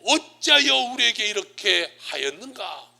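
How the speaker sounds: stately, forceful Bible quotation; scripture quote. Retold or imitated. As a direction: quote scripture loudly and slowly, drawing out the words. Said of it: "오늘 성경에 뭐라고 말해요?".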